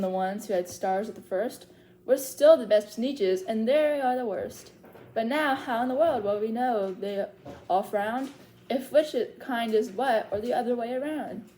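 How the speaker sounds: lively singsong read-aloud of a rhyming book; story reading to children. Acted. Librarian reads Seuss rhymes to little story timers, lively singsong. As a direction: read the rhymes to the kids with bounce.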